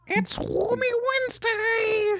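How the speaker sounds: very high squeaky squid voice with a laugh; high cartoon squid, laughing; gurgly squid voice. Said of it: "I'm gonna cry".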